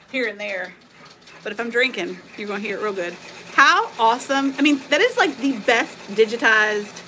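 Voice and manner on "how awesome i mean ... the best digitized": high, loud delighted gush; excited gushing. Real, not acted. Crafter gushing to viewers over her embroidery, thrilled. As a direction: squeal with delight at the stitching.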